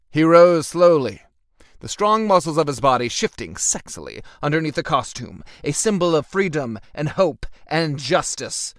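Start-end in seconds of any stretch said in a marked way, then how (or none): none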